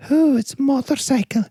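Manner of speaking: put-on high, cooing girlish voice; Pulp Fiction girlfriend. Girlfriend cooing at her boyfriend, high and sweet. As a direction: coo it like a sweet, naive girlfriend. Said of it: "That's, that's Pulp Fiction".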